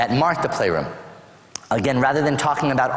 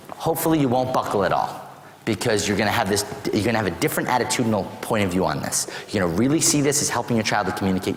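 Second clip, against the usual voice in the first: usual speaking voice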